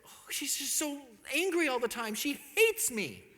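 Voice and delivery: fretful, dragging out the last phrase; wounded wondering. Retold or imitated. Husband wondering about his angry wife, wounded and fretful.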